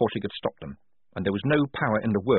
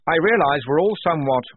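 higher, louder formal address; officious lieutenant; 7 semitones higher, 6 dB louder than usual. Acted. Blouse addressing the confused squad, officious. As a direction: announce it primly, pitch lifted.

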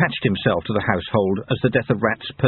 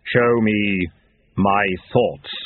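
grim reaper voice; 2 semitones lower, much slower than usual. Acted. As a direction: intone it slowly as Death. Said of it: "said Death".

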